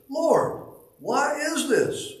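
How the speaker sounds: high, slow pleading cry to the Lord; trembling cry. Acted. The hymn's speaker crying out to the Lord in anguish, trembling. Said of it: "I cried, trembling".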